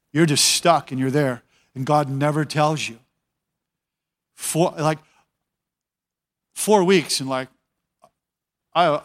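ordinary delivery

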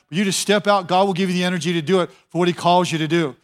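ordinary delivery

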